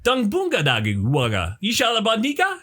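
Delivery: Sims-style gibberish chatter; Simlish gibberish. Acted. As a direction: babble like a Sims character. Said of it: "become a sim".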